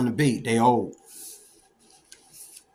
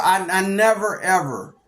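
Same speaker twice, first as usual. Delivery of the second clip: normal talking voice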